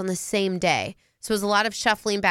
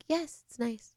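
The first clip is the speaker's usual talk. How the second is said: much softer, unimpressed; flat bored teen; 3 semitones higher, 9 dB quieter than usual. Retold or imitated. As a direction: mumble it like a bored kid. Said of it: "your kids are like".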